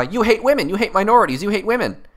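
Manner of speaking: mock ranting critic. Parodying the critics' rant.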